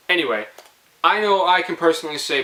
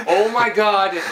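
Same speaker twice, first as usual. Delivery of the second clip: higher, laughing "Oh my God"; 7 semitones higher, 4 dB louder, slower than usual. Real, not acted. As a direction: gasp it out mid-laugh. Laughing after story.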